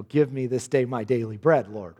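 low, gentle asking; humble prayer. Retold or imitated. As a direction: pray it meekly. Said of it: "that doesn't sound like".

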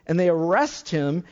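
ordinary delivery

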